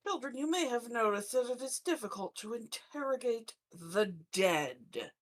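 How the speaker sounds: ordinary delivery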